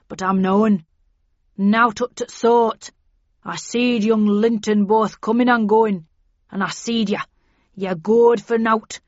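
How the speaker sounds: put-on loud Yorkshire dialect rant from a sinewy old man; old Yorkshireman sounding off loudly; excited old servant. A narrator's reading. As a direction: launch into a loud, indignant rustic tirade. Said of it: "he launched into an excited gabble".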